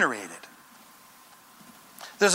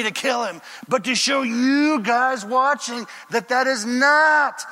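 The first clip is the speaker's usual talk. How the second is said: higher, emphatic rebuttal slowing to weighty words; David refusing; 5 semitones higher, slower than usual. Acted. David correcting his men in the cave, emphatic. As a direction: push back firmly, drawing out the key words. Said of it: "David said".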